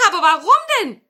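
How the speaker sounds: high, loud question; grandmother calling. Acted. Grandmother answers the wizard through the door, high loud call. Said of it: "rief die Großmutter".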